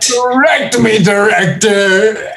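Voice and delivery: high drawled taunt, slow at the end; a drunk sheriff taunting the "director"; drunk southern sheriff. Retold or imitated. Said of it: "in a really southern drawl".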